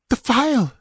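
high, urgent female character voice; gasping urgency. A narrator's reading. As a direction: cry it out, gasping and desperate. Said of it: "she gasped".

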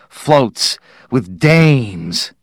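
very slow, lingering on dames; savoring drawl. Acted. Parkhurst dreaming aloud to crew of parade girls, slow relish. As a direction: stretch it out with relish.

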